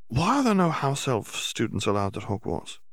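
lightly voiced eager schoolgirl, probing; curious Hermione. A narrator's reading. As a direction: Hermione haltingly asking the house elf a question. Hermione asking.

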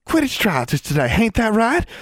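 put-on high eager girl voice, out of breath from running; breathless country girl. A narrator's reading. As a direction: gasp it out after running down the hall. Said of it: "she asked breathlessly".

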